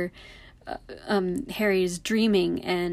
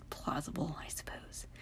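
whispered aside; 4 semitones lower, 14 dB quieter than usual. Real, not acted. Skeptical aside on a fan theory.

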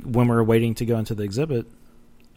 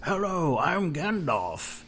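slow, higher wizard voice, lightly done; Gandalf voice; 6 semitones higher, 5 dB quieter, much slower than usual. Pretend. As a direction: drawl it out like a playful old wizard. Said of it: "I'll do all the voices".

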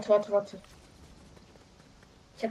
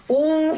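high, loud "oh" breaking into laughter; 6 semitones higher, 5 dB louder than usual. Real, not acted. Co-host reacting to the joke's punchline, loud laughing. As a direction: burst out in a long, falling laugh. Cracking up at a joke.

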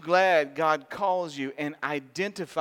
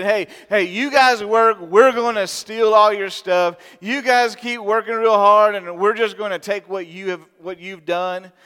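mocking bully; 5 semitones higher, 10 dB louder than usual. Bullies taunting the Israelites.

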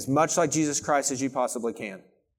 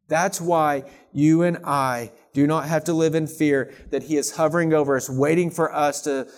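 normal talking voice

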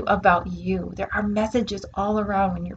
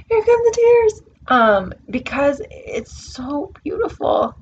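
high, louder, tear-choked voice; tearful; 6 semitones higher, 5 dB louder than usual. Real, not acted. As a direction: speak as the tears break through.

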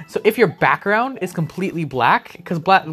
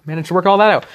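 speaking through coughs; strained after coughing; coughing fit; 4 semitones higher, faster than usual. Real, not acted. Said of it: "Sorry, I'm coughing so much".

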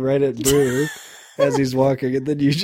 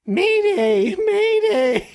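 very high whiny mock mayday; high whimpering mock distress call; silly ADR voice; much slower than usual. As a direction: squeak it out like a pansy dub. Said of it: "A D R with someone on the walkie-talkie".

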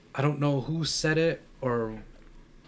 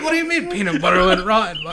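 grandmother; 6 semitones higher, 7 dB louder than usual. Retold or imitated. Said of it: "She's like".

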